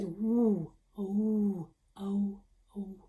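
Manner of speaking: "woo, oh, oh" said softly with rounded lips; soft rounded "oh" repeated quietly; quiet oh sound. Shown as a demonstration. Teacher demonstrating the w sound to the class. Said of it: "It's very quiet".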